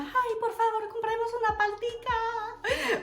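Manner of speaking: put-on high, drawn-out pleading child voice; high whiny plea for an avocado; whiny begging. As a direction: beg like a kid at the market. Kids begging for fruit.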